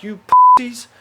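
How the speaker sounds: high, loud mock berating; loud, scornful acted jab; exasperated outburst. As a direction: call them out at full pitch. Acted outburst at hostel roommates.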